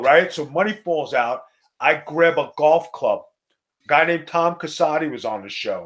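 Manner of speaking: ordinary delivery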